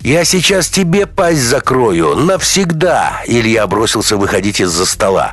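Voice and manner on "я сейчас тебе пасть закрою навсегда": slow, high furious yell; furious threat. Acted. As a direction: roar the threat at him. Ilya threatens while restrained.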